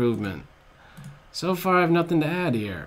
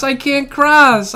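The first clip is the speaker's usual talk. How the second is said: whine; 11 semitones higher, 10 dB louder, slower than usual. Real, not acted. Repeated whine, higher.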